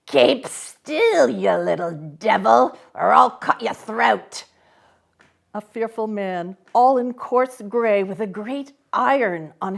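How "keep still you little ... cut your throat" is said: louder, menacing convict voice; threatening convict. A narrator's reading. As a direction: growl the threat at the boy.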